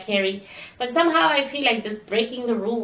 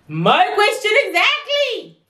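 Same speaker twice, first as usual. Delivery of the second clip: excited agreement; 11 semitones higher, 4 dB louder than usual. Real, not acted.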